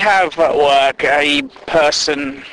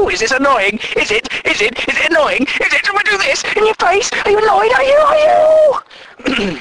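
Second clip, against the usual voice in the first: annoying pest. Retold or imitated. Office pest poking a tired colleague, deliberately annoying. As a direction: badger them until you screech. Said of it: "kind of annoying people".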